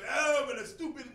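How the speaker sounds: loud, mock-uppity outburst; uppity dismissal. Preacher mimics uppity critics of the ancestors, loud and dismissive. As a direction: scoff loudly like someone getting uppity. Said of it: "we get very uppity hop and say".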